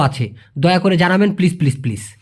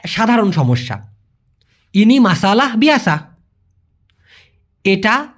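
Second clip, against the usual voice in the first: ordinary talk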